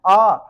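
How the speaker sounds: long open आ with lips kept flat; drawn out aa. Shown as a demonstration. Teacher drawing out आ for the class. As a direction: stretch the आ so students can hear it. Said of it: "जैसे कहा".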